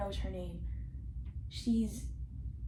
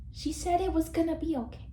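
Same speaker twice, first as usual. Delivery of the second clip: normal talking voice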